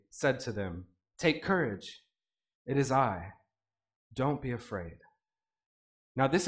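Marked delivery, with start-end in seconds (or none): 1.2-5.0 soft, low and calming; reassuring Jesus. Acted. Jesus calling to the frightened disciples, soft and reassuring. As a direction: settle their fear gently.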